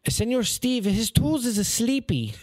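high, accented imitation of coworker; imitating the coworker's accent, high; Guatemalan coworker. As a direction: deliver it in his broken English. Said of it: "he says".

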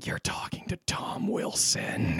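slow, hushed whisper aside; slow awed whisper. Retold or imitated. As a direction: whisper it like a secret to the room. His inner aside, whispered.

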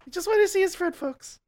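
high, fast Jay Leno impression; chirpy, sped-up Jay Leno voice. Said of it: "my Jay impression".

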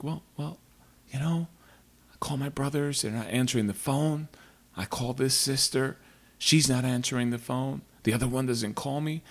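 a hint of a hurt mom complaining; mom's voice. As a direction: son imitating his mother's worried complaint. Son voices his upset mom.

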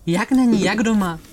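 outraged disbelief. Acted. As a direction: fire back, stunned and furious. Guest outraged chef left.

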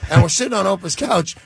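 ordinary talk, nothing marked

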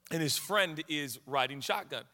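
usual speaking voice

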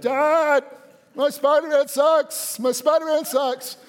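put-on high, loud, drawn-out child's whine for Dad; young son calling. Son yelling to his dad from bed, high-pitched calling. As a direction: call out high and loud like a small kid in bed.

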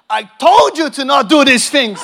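loud retold outburst with a laugh in it; mock scolding shout. Preacher acting out a lady scolding her friend, mock exasperated shout. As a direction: scold loudly, playing it for laughs.

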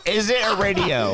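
laughing. Real, not acted. Excited guess about the present.

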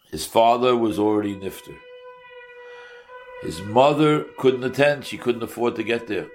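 normal talking voice

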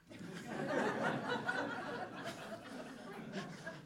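long high breathless laugh; real laughter that keeps rising; high laughing fit. Real, not acted. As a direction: crack up at your own punchline. Loud laugh at punchline.

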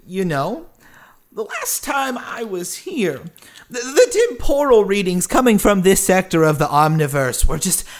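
showy, high swooping folksy voice; genial stranger, effusive. Acted. Stranger explaining his travels to townsfolk, effusive and swooping. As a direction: hold forth grandly, pitch leaping on key words.

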